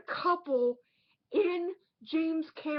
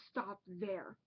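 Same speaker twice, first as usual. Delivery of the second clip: lower, softer and slow repeat; low dramatic echo; 7 semitones lower, 13 dB quieter than usual. Acted. Reviewer repeats his point to viewers as a low echo. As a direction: let the echo sink low and ominous.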